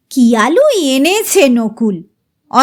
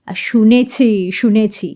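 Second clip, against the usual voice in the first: calm her down, gravely; grave reassurance; 5 semitones lower than usual. Acted. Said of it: "দাদা অভয় দিলেন গম্ভীরভাবে". (দাদা গম্ভীরভাবে অভয় দিলেন)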